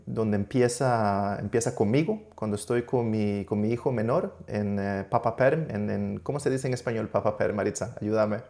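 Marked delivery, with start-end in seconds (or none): none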